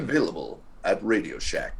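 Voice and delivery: low voiceover tag line; deep announcer impression. Having a go at that guy's voice, low announcer impression. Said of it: "It's a very low".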